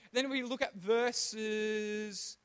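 ordinary delivery

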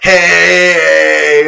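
loud, high protesting shout; indignant yell. Retold or imitated. Choir friend finding a gelled drink, loud indignant shout. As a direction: shout like your drink was ruined.

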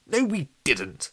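slow, high, defiant denial; brave denial. A narrator's reading. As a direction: stand up to the ghost. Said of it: "bravely trying to get his head around".

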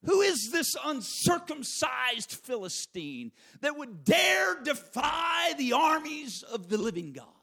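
slow, loud challenge to the giant; David shouting his defiance, slowly; defiant shout. Acted. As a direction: hurl the question at the Philistine.

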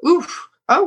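ordinary talk

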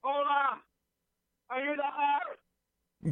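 high, slow squeaky voice. Acted. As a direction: draw out a tiny squeaky voice. S2 puts on a high voice.

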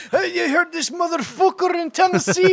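high, hammed-up Irish accent; bad Irish accent. Playing a Dublin listener, exaggerated bad Irish accent. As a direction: put on a terrible shrieky Irish accent. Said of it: "That's the worst Irish accent ever".

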